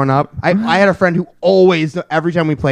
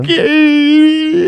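high, drawn-out tearful squeak; mock-crying child giving in; whimpering kid; 12 semitones higher, 3 dB louder than usual. Plays sulking kid, pretend crying.

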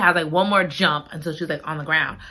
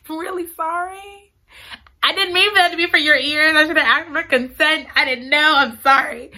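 high-pitched, hurried mock apology; sheepish apology; 7 semitones higher than usual. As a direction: plead innocence to embarrassed strangers. Mock apology to nearby cars.

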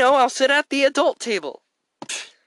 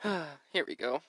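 resigned sigh; 12 semitones lower, 16 dB quieter, slower than usual. Acted. Sighing, resigned.

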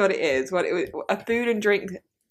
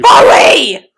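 loud shout at the cat; 9 semitones higher, 17 dB louder than usual. Real, not acted. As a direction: yell the name sharply. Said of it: "You do not have to raise your voice to the cat".

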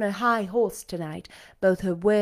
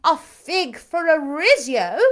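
crying out; 7 semitones higher, 6 dB louder, slower than usual. A narrator's reading. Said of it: "cried she".

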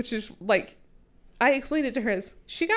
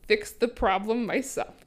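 ordinary talk, nothing marked

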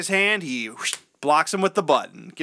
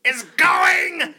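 much higher, loud crying shout; hammed-up scream; 13 semitones higher, 4 dB louder, much slower than usual. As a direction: shriek the prophecy in mock terror. Walken screams at father.

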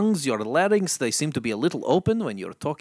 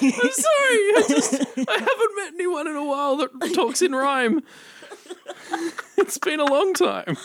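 high-pitched giggling apology; laughing; 8 dB louder than usual. Real, not acted. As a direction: talk through helpless laughter.

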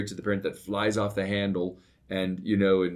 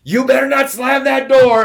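full-force yell, much higher and louder; 16 dB louder than usual. Retold or imitated. Father yelling down the stairs at his daughter, full shout. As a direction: bellow it down the stairs. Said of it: "I just yell down the stairs".

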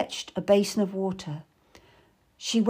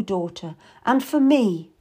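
plain reading voice

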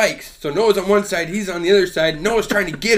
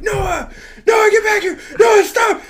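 much higher, loud yelling of the dog's name; retold screaming at dog; 6 dB louder, slower than usual. Owner yelling at his dog to stop chasing, high loud shout. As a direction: shout desperately after a runaway dog. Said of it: "I'm screaming for him".

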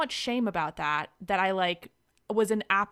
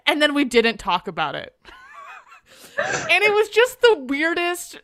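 high laugh rolling into louder words; 9 semitones higher, 9 dB louder than usual. Real, not acted. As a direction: laugh through the awkward memory. Laughs retelling the awkward moment.

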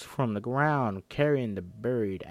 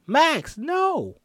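shouting; 9 dB louder than usual. Acted. Character warns Max.

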